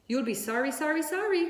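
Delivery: high honking warning, rising; goose calling. A narrator's reading. Goose warning Wilbur he'll be sorry. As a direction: call after him like a goose. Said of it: "called the goose".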